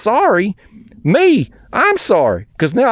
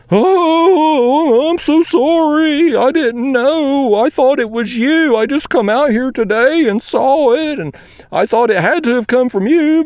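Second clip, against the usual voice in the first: higher, mock-sorry imitation of the neighbor; mock apologetic neighbor; 7 semitones higher, slower than usual. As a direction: mimic the neighbor sweetly backpedaling. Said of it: "he changes his tune".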